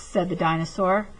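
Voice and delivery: ordinary delivery, nothing marked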